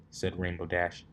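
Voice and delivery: plain reading voice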